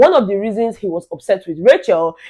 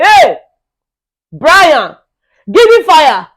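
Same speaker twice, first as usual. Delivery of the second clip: loud, raised call, one name at a time; demonstrated loud call-out; 6 semitones higher, 5 dB louder, much slower than usual. Acted. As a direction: call each person out sharply. Demonstrates calling people out by name.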